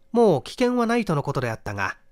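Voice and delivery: plain reading voice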